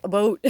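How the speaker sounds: "About" said the Canadian way, like "aboot". Retold or imitated. Teasing her partner with a mock Canadian "aboot". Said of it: "channeling, like, a Canadian accent".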